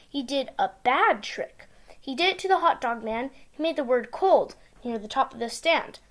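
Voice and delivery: ordinary delivery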